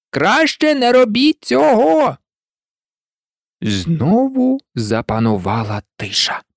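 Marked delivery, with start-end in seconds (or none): 0.1-2.2 high, slow, drawn-out cry; warning shout. A narrator's reading. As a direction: call it out slowly as a warning. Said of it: "і закричала". (протяжний застережливий крик)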